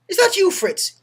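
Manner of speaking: high, loud questioning shout; Irish soldier calling back. Acted. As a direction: shout back across the trenches. Irishman answers back in the joke.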